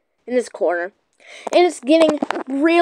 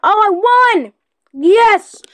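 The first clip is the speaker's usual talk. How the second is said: sudden triumphant yell of victory; excited cheer; 8 semitones higher, 5 dB louder, much slower than usual. Real, not acted. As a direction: shout it out, thrilled to win. Winning the round.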